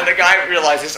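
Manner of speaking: ordinary talk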